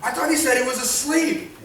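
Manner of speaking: a baffled disciple who just misheard; confused disciples. Retold or imitated. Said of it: "can you hear it in their voice?".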